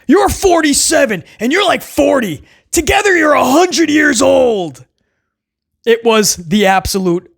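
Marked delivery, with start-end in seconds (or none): 0.1-4.9 quoting again. Retold or imitated. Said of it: "That was a quote".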